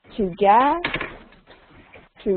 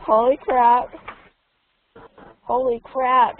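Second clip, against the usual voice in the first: American guy impression; 3 semitones higher, 4 dB louder, slower than usual. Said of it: "so he probably says".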